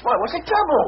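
high, startled question; suddenly wary. Acted. Jerry caught off guard by the buyer's question, startled. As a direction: jump at the sudden concern.

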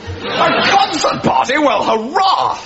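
high, drawn-out gleeful surprise; overjoyed hurrah; delighted enthusiasm. Acted. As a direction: welcome the plan with a slow cheer. Delighted at the concert party.

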